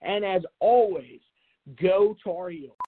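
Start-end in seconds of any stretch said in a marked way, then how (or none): none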